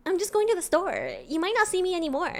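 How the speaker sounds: put-on high, innocent girl-next-door voice. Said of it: "She would say".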